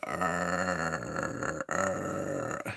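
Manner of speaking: low soft groaning arr; ghost groan. Retold or imitated. Describing the ghosts Halloran sees, low soft moaning groan. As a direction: moan like a dead person. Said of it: "The ones that were like".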